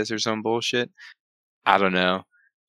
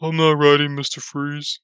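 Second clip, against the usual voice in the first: higher, whiny scared voice; mocking whine; 7 semitones higher than usual. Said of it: "oh, bullshit, bro".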